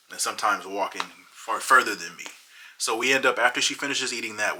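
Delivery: ordinary talk, nothing marked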